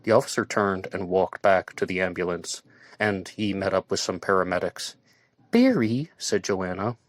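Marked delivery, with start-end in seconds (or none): 5.5-6.1 high, loud call with a rise. A narrator's reading. Joanna getting Barry's attention, high call. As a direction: call his name up high.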